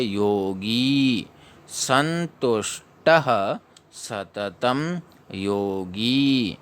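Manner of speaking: योगी with a long final ई, slowly; teacher models योगी slowly. Shown as a demonstration. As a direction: stretch the ई in योगी. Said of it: "ग में एक ही मात्रा बड़ी है".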